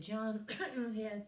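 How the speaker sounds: cough over syllable. Retold or imitated. Calling roll around a rude surname, cough-masked syllable. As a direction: cough away the first syllable, toss out the last. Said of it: "I'll just cough on the, on the first syllable".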